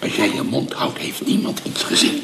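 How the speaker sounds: hushed, voiceless whisper. Acted. Conspirator whispering a deal to his accomplice, hushed. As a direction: keep it secret, barely breathe it.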